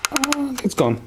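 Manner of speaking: sudden high yelp of relief; pleased surprise as the part pops free; surprised relief. Real, not acted. Flywheel turn frees stuck part.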